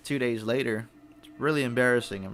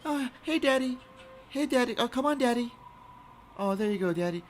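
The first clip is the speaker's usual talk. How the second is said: put-on high cooing mom voice to a baby; imitating a mom cooing at her baby; mom baby talk; 11 semitones higher than usual. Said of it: "is calling her little tiny baby, uh, daddy".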